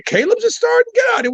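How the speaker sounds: high-pitched incredulous outburst; shocked disbelief. Real, not acted. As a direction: react like the news can't be true. Reacts to news about starting player.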